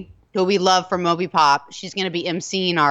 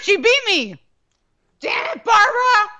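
frustrated shout; 13 semitones higher than usual. Real, not acted. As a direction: yell it out, losing the race. Losing hot dog eating contest.

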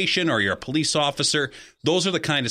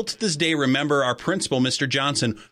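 normal talking voice

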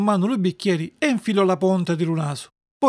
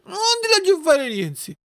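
put-on high, drawn-out childish wail; wailing protest; 11 semitones higher than usual. Puppet interrupting the fairy's warning, wailing protest. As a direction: drag it out in a stubborn wail. Puppet's tantrum over the medicine.

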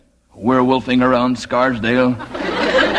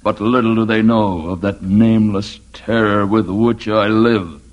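normal talking voice

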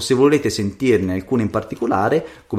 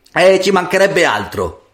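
raised pitch, offhand retort; scornful refusal; 7 semitones higher, 4 dB louder than usual. A narrator's reading. Careless daughter refusing her mother's errand, scornfully. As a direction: toss back a dismissive reply. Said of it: "rispose quella sbadata".

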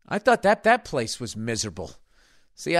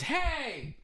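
letter writer; 11 semitones higher, 9 dB quieter than usual. Acted. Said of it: "He says".

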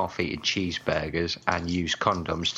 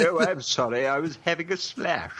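mock Hugh Bonneville; 9 semitones higher than usual. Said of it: "I'd get Hugh Bonneville".

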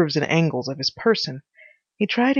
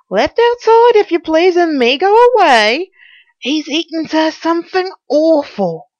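loud, high servant's lament; aggrieved housekeeper; 10 semitones higher, 8 dB louder than usual. Acted. Housekeeper complaining about the food he demands, high and loud. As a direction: complain at full pitch as the housekeeper.

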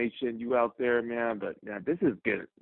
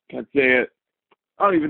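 louder frustrated burst; angry outburst; 7 dB louder, faster than usual. Real, not acted. Caller breaking off his rant to the host, loud and frustrated. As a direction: blurt it out, fed up. Caller too upset to finish.

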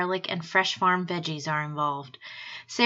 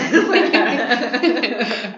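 laughing; 3 semitones higher, 7 dB louder, faster than usual. Real, not acted. Laughing at partner's joke.

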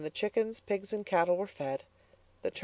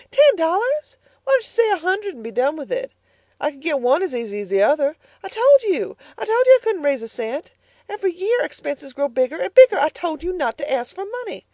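much higher, louder furious tirade; angry outcry; 12 semitones higher, 6 dB louder than usual. Acted. Mrs Comstock shouting at Elnora about money, much higher and louder. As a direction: explode at the request. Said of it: "cried Missus Comstock".

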